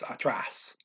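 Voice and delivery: "atrás" with the accent on "trás"; teacher restating "atrás" with its last syllable stressed; accented final syllable. Shown as a demonstration. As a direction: say "atrás" as ah-TRAHS, stress at the end. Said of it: "you have to accent that".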